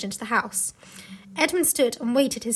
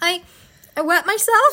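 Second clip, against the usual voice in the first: put-on high boy voice; 6 semitones higher, 6 dB louder than usual. Comic Edmund voice.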